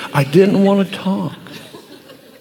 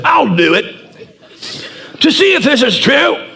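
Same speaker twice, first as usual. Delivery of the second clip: laughing mock English accent, a bit higher; 5 semitones higher than usual. Driver teasing the preacher, laughing mock English accent. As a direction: tease the pastor in a laughing English voice. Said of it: "and he said".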